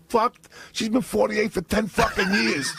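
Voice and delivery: high, outraged aunt's retort. Retold or imitated. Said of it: "she goes".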